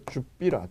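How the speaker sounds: 줍비라 said in Gyeongsang dialect as jup-bi-ra; 줍비라 voiced the Gyeongsang way; Gyeongsang dialect word. Shown as a demonstration. Lecturer repeating the Gyeongsang word 줍비라 for students to write down. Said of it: "경상도 말로 뭐 하라고요".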